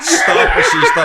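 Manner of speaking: high, loud laughing plea. Real, not acted. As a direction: beg them to stop, laughing. Cracking up at a joke.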